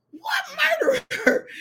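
high, slow incredulous question; mock disbelief. Retelling her reply to mother's news, high mock disbelief. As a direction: stretch it out in a high squeal.

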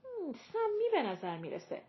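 high, wrinkled-nose disgust. A narrator's reading. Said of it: "با انزجار اعلام کرد". (با انزجار، صدای زیرتر)

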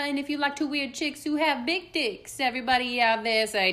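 loud hype show intro; announcer voice. Acted. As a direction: belt it like a radio host. Podcast intro announcer opening.

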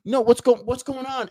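alarmed confusion. Retold or imitated. Martha getting alarmed.

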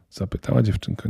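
usual speaking voice

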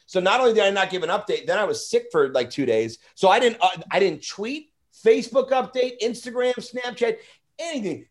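ordinary talk

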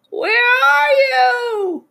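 very high, slow, drawn-out call; long wailing cry. Acted. Toad blindly seeking Frog, wailing cry. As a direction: call out searching, stretching the words. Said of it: "cried the thing".

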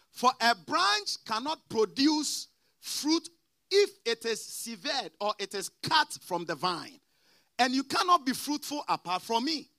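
preaching scripture. Acted. Preacher reads verse aloud.

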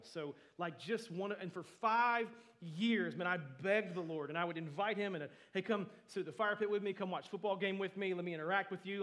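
loud, impassioned testimony, building as it goes; preaching at full force. Acted. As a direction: pour your heart into the story of [name], full voice. Impassioned personal story in sermon.

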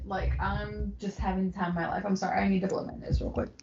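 choked up and quiet, near tears; holding back tears while talking; emotional. Real, not acted. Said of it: "She's getting emotional".

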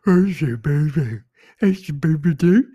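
mocking parent cooing at a baby; dog voice for a baby. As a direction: gush at the kid like it's a puppy. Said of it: "They do the same voice with their kid".

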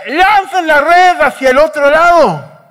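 high, loud call across the water; sustained carrying shout; shouting instructions. Retold or imitated. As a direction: holler the order from the shore, stretching the phrases. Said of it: "grita y dice".

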